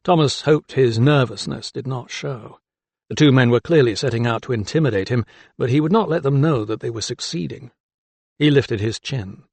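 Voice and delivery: usual speaking voice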